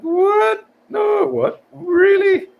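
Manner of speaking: very high, squeaky disbelief; over-the-top high-pitched what really; mock shock. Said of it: "I'm like".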